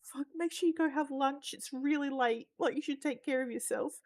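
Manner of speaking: a gentle, fussing lady; kind woman. Retold or imitated. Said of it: "she's like".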